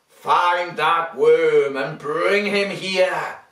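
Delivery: slow, loud sinister lizard voice; a scheming villain giving orders; villain mutter. Acted. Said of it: "He mutters in his servant's ear".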